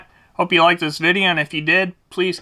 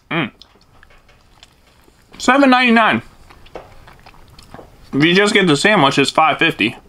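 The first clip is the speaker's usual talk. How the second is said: chewing while talking; speaking between bites; mouth full of food; 3 semitones higher, 4 dB louder than usual. Real, not acted. Seen 2: Takes big bites at 0.2–1.9, then chews while speaking.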